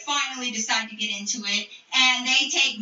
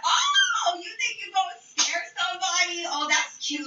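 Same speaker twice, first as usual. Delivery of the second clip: mocking comeback; 6 semitones higher than usual. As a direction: scoff at the threat, pitched way up. Mocking comeback to rival climbing table.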